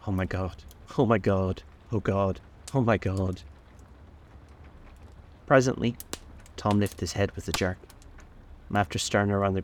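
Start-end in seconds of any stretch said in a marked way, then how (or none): 0.1-3.4 a repeated grieving call, slowing at the end; anguished moaning call. A narrator's reading. Wilson over his dead wife, a despairing moan. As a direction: groan it out in despair. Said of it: "his high, horrible call".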